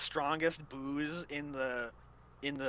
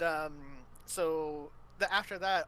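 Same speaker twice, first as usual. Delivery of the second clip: ordinary delivery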